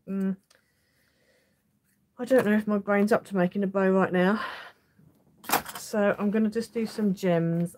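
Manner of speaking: ordinary delivery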